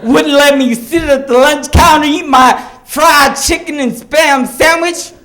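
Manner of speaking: ordinary delivery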